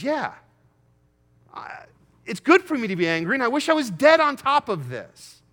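loud, high, bitter outburst; defiant Jonah. Acted. Preacher as Jonah shouting back at God, bitter and loud. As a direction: Jonah snaps back that he'd rather be dead.

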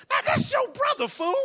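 high, loud acted outburst; exasperated, high-pitched comeback; indignant retort. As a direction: throw it out in mock outrage. Acts an indignant retort about brother.

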